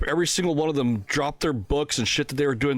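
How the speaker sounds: usual speaking voice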